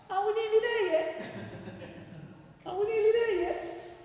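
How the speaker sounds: put-on high-pitched, whining kid on a long car trip; whiny child. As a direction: pester like a bored child in the back seat. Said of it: "He is like a child on a journey".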